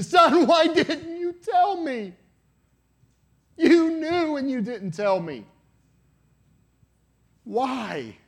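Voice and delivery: anguished high scream, drawn out; desperate, tearful shriek of reproach; crying scream. Retold or imitated. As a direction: wail it at full force, like a father dragged away. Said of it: "he's crying and screaming".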